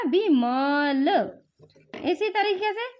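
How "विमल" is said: Vimal stretched very slowly to the end; patient teacher blending; slowly blended word. Shown as a demonstration. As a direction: draw out Vimal for a child. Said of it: "आ का sound आया?".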